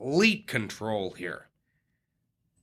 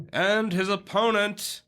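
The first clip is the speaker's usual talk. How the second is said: drawn-out, big boxing-style introduction; ring announcer; 10 semitones higher, slower than usual. Acted. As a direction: stretch the name like a ring announcer.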